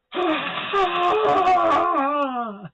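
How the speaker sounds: high, loud cackling scream; evil shrieking laugh. Acted. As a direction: let out a monster's shriek. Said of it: "I wish I could be doing, like, the screams".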